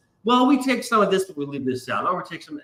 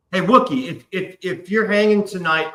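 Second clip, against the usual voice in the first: ordinary talk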